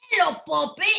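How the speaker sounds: lightly done pirate hailing someone. Pretend. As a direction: try out a cartoon pirate on request. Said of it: "That's all I got for pirate talk".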